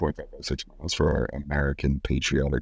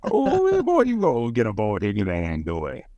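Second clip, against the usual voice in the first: Louisiana Cajun accent; 4 semitones higher than usual. Shown as a demonstration. Co-host finishing his Louisiana bit with a high Cajun whoop. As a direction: hype him up like a Lafayette gumbo man. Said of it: "my best accent is, like, a, uh, Lafayette, Louisiana".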